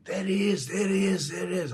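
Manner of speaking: high-pitched imitation of a crowd calling. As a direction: mimic excited onlookers in a high voice. Crowd spotting him, retold.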